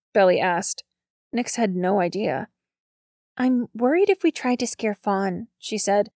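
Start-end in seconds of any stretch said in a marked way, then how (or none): 3.4-5.5 lightly voiced worried girl, pitched higher; a touch of worry, lifted in pitch; worried Nix. A narrator's reading. Nix frets to Belly about the plan, lightly worried.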